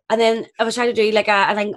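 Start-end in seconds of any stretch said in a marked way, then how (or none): none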